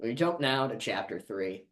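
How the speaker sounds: ordinary delivery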